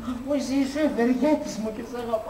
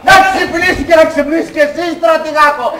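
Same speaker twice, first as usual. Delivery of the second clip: put-on loud, defiant drunken yell; 4 semitones higher, 10 dB louder than usual. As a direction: wake the whole house, drunk. Drunk yelling at night.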